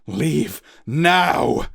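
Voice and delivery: father ordering his son off the ship; roared command. Acted. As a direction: send him away forcefully. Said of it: "roared Cloud Wolf".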